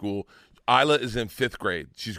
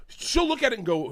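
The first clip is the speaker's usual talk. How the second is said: ordinary talk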